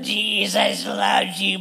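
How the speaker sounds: slow, snarled mock scream; imitated scream. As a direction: mimic the hateful delivery, words dragged out. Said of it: "the way it's delivered".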